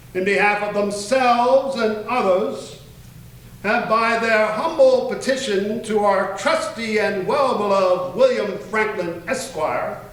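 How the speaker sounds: grand formal read of a royal decree; officious proclamation. Shown as a demonstration. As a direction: proclaim it like a herald before the crown. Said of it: "make this as officious as I can".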